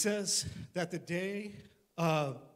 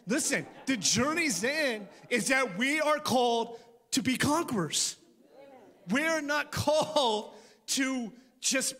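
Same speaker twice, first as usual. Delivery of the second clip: louder, slower preaching build; 5 semitones higher, 5 dB louder, slower than usual. Acted. Pastor rallying the church to conquer, full-force preaching.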